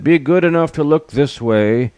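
high, proclaiming royal voice; lion monarch. A narrator's reading. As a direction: lay down the law as a lion king. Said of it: "The lion stood and said".

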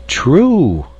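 astonished. A narrator's reading. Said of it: "murmured the astonished insect".

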